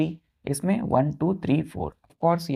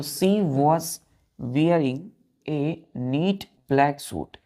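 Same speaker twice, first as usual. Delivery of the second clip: slow dictation; much slower than usual. Shown as a demonstration. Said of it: "dictation pace".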